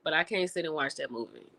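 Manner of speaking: normal talking voice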